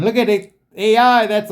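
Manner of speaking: sudden loud burst of excitement; excited. Real, not acted. Reacting to the screen, an excited loud burst. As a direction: jumps up high and loud at the discovery.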